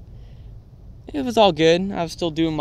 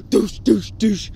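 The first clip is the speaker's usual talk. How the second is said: higher, quick running sound effects; gasp then mock dashing noises; 5 semitones higher, 4 dB louder, slower than usual. Teacher rushing to slap away the snack, mock dash sounds. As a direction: imitate someone rushing over.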